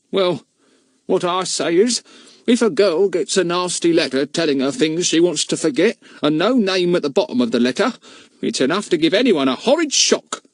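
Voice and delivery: a village woman talking over the fence; lightly voiced gossiping housekeeper; housekeeper's voice. Mrs Moon voiced.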